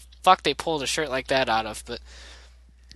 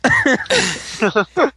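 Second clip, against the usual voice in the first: squeak; 11 semitones higher, 5 dB louder than usual. Acted. Sudden squeaky word.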